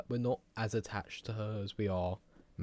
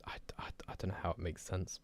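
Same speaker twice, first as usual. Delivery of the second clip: whispering; 3 semitones lower, 9 dB quieter, faster than usual. Real, not acted. Aside about the theorist.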